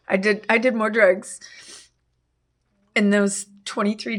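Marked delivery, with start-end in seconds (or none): none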